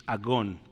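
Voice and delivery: agón with a hard g as in gato, stress on the last syllable; slowly: a-GÓN, hard g; Greek word agón. Shown as a demonstration. Pastor explaining the g sound, slowly. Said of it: "G de gato".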